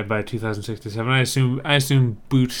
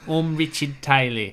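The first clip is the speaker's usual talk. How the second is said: Richard Taylor impression; 3 semitones higher than usual. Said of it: "my impression of Richard Taylor".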